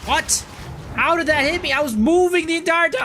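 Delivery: high, loud indignant yell; furious disbelief at the hit; raging gamer. Real, not acted.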